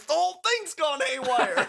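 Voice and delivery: high, hammed-up wail; Twin Peaks voice. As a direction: cry it out in an exaggerated put-on voice. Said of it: "Twin Peaks voice".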